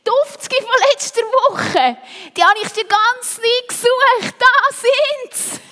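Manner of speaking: high, loud squeal of discovery; excited calling out. A narrator's reading. Freddy finds what he searched for, excited shouting. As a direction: cry out with delight at a find. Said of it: "rief der Freddy Feldmaus".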